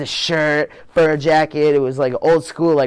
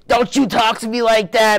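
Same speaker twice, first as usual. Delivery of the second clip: high, louder stern mom voice; a mother telling off her son, pitched up; mock-stern mother scolding; 10 semitones higher, 5 dB louder than usual.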